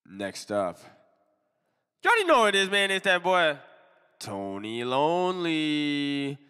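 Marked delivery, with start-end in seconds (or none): 2.0-6.4 loud drawn-out hype intro; hype announcer. Acted. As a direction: announce the rapper like a host. Hypes the next contestant.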